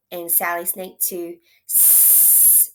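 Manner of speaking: ordinary talk, nothing marked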